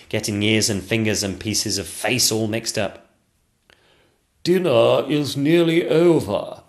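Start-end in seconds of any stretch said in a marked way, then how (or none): 4.4-6.6 butler warning. Acted.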